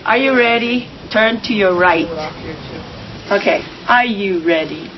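ordinary delivery

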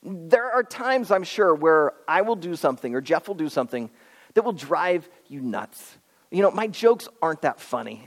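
ordinary talk

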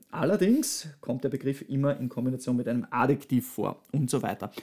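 ordinary talk

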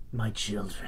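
low, soft demonic greeting; a dark lord welcoming his flock; low haunting voice. Acted. Asmodeus figure addresses worshippers.

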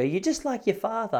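high, accusing mum voice; scolding mum. Retold or imitated. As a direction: throw it at him like a mum mid-fight.

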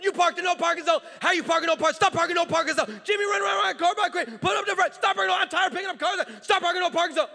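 loud, high-pitched mock tirade; hammed-up furious hollering; irate ranting. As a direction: yell a scolding without letting up. Imitates an irate man's parking rant.

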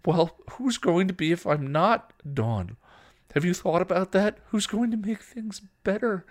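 loud, high, anguished questions; desperate weeping. A narrator's reading. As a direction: demand it desperately through tears. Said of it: "Buffy wept openly, desperately".

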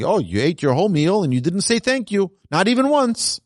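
high, whiny hurt-parent voice; a hammed-up plaintive lament; mock hurt complaint. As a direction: play a wounded parent whining about no thank you. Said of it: "Hashem is not sitting there crying. He's saying".

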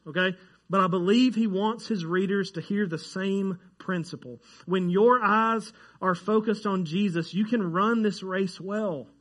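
ordinary delivery

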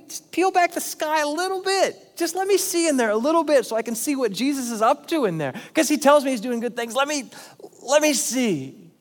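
high wistful plea to see heaven; longing plea. Retold or imitated. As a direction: reach up as if peering into heaven. Imagined plea to peek into heaven.

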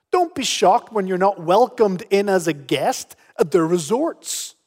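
ordinary delivery

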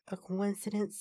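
usual speaking voice

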